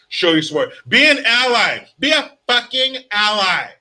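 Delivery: loud, high rallying cry; hammed-up rally yell; mock rally shout. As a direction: shout it like a hammed-up Pride chant. Hyped Pride shout-out.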